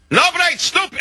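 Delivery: very high-pitched ranting of an enraged Russian; mock Russian screaming. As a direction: imitate a livid Russian shrieking in broken English, without extra volume. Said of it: "he's screaming and yelling".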